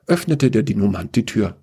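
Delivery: ordinary delivery, nothing marked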